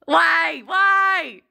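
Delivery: loud, high wail of why; exasperated cry. Retold or imitated. Alone in the empty lab, a loud, high wail. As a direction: throw it at the ceiling.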